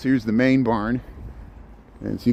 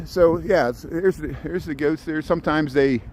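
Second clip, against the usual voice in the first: usual speaking voice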